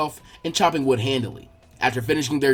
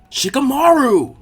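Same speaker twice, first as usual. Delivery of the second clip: slow, much higher, loud call across the yard; yell; 9 semitones higher, 7 dB louder, slower than usual. Acted. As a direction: shout his name to wake him up. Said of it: "Naruto yelled".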